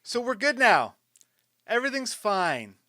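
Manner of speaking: high, loud mock-cheerful reassurance; sarcastic. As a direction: chirp it with forced calm. Ironic reassurance after stress.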